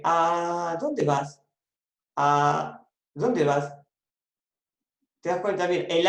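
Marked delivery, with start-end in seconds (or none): 0.0-3.8 very slow, the lone A in dónde vas set apart; slow demo phrase. Shown as a demonstration. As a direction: say it slowly so the single A stands out. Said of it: "por ejemplo, yo digo".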